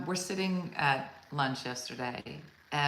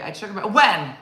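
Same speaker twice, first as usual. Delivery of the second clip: higher, louder suspicious grilling; aggressive mom; 4 semitones higher, 9 dB louder than usual. Retold or imitated. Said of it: "She's, like, real aggressive".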